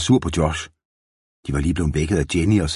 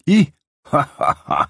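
gurgling laugh; 10 semitones higher, 4 dB louder than usual. A narrator's reading. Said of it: "det gurglede fra deres fanges mund".

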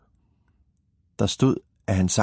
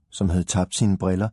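ordinary delivery, nothing marked

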